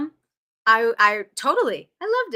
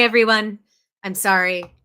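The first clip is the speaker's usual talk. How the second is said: usual speaking voice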